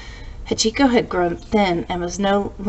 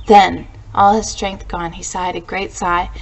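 usual speaking voice

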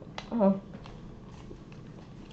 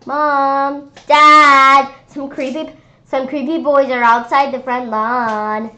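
loud, high call to parents; shouting; 6 semitones higher, 12 dB louder, slower than usual. A narrator's reading. Sister shouting to parents about creepy boys, loud and high. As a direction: yell for mom and dad across the house. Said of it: "started shouting to our parents".

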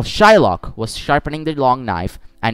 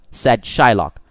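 usual speaking voice

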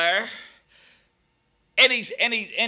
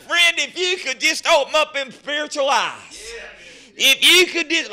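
usual speaking voice

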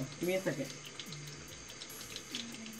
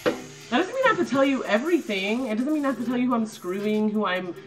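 irritated rant; 5 semitones higher, 5 dB louder than usual. Real, not acted. Ranting about commenters.

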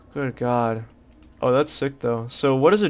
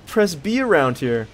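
normal talking voice

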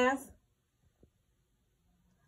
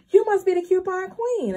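higher, admiring exclamation; excited stranger; 6 semitones higher, 4 dB louder than usual. Retold or imitated. Lady walking up to a couponer, excited and admiring. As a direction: imitate an impressed shopper. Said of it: "She was so excited".

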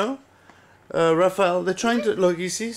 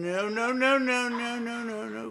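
soft cooing; 7 semitones higher, 7 dB quieter than usual. Real, not acted. Soothing child at the computer.

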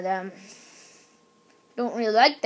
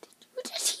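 whisper; 16 dB quieter than usual. Real, not acted. Sudden hushed surprise.

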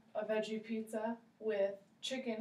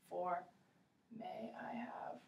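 soft, unvoiced "may I have"; quiet whispered "may I have"; whispered quote; 9 dB quieter than usual. Shown as a demonstration. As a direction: breathe the phrase out. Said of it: "teacher whispers quoted phrase".

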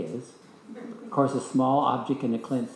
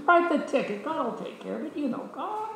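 breezy confident; 11 semitones higher than usual. Retold or imitated. Answering travel agent about ticket.

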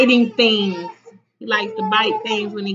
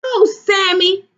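put-on high-pitched children's outcry; raised, reproachful group cry; 8 semitones higher, 4 dB louder than usual. A narrator's reading. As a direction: exclaim it in dismay. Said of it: "cried the children".

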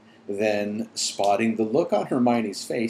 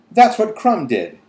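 high, loud blurt instead of a whisper; loud, not whispered; 12 semitones higher, 9 dB louder than usual. A narrator's reading. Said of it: "Hermione whispered".

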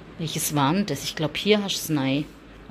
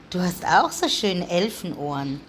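ordinary delivery

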